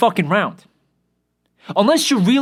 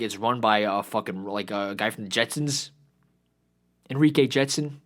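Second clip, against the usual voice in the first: ordinary talk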